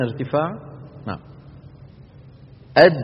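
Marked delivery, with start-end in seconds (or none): 2.8-3.0 أد with a light د, tongue tip only; demo of dal sound. Shown as a demonstration. Teacher models أد, asking students to repeat it. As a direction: say أد crisply, no raised back. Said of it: "قولوا أد".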